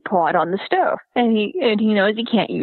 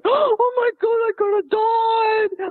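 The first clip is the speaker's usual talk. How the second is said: mock panic; 11 semitones higher, slower than usual. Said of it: "he freaks out".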